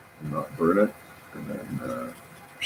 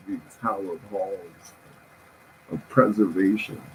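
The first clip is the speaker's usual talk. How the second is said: mock solemn herald; 3 semitones higher, 4 dB louder than usual. Laughing at your own jokes.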